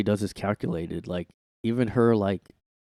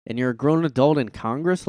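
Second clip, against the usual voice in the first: usual speaking voice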